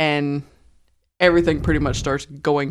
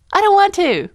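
put-on high whiny complaining girl; 3 dB louder than usual. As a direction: whine it like an annoying teen. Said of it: "the annoying girl's like".

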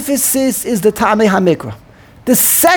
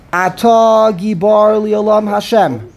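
slow, measured chant of the line; slow prayer reading; slower than usual. Shown as a demonstration. Rabbi demonstrating to the student, slow chant. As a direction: read it out slowly as in davening. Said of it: "chanting a prayer line to show trop".

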